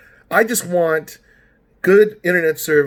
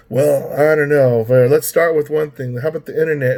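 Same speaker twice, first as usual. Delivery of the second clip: imitated retention lady; 3 dB louder than usual. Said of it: "she did talk like this".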